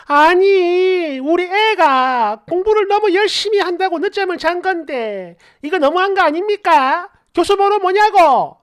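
high-pitched mock indignant mother. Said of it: "이런 식으로 언성 오지게 올라가니까".

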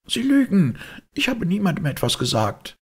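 high, loud indignant outburst; shrieked denial. Acted. Maid denies informing anyone, high indignant shriek. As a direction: fling the accusation back, voice shooting up.

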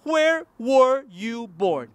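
where were you born, drawn out slowly; teacher demo at a crawl; slow model phrase. Shown as a demonstration. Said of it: "Una vez más".

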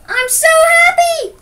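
very high, loud happy squeal; loud, elated story-book yell; excited shout. A narrator's reading. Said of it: "shouted Joe excitedly".

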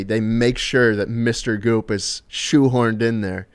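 ordinary talk, nothing marked

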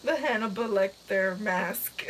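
ordinary talk, nothing marked

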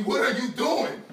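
ordinary talk

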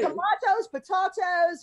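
high, loud put-on British tomahtoes; mock British tomatoes. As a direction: sing out tomahtoes like a posh Brit. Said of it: "we should all talk British for this".

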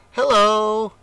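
high, loud friendly call; baby rabbit calls out a cheerful hello. A narrator's reading. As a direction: call across to someone far off. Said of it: "he called".

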